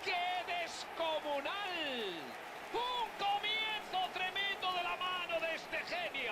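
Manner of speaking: screaming goal call, high pitched; breakneck goal commentary. Acted. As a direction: stretch out the goal cry. Goal scored, commentator erupts.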